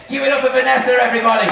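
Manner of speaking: put-on rally the crowd for the girl; loud emcee call for applause. Calling for applause for child.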